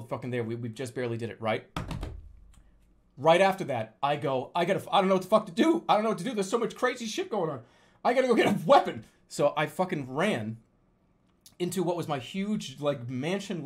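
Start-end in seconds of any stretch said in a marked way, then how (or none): 4.5-9.0 rapid, higher frantic babble; acted panic. Dreamer deciding to arm himself against tiger, fast mock panic. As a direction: tumble through it, building to a loud resolve.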